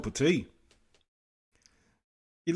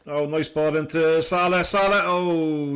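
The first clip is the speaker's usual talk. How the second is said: excited; 5 semitones higher, 8 dB louder, slower than usual. Real, not acted. Chance for Salah in match.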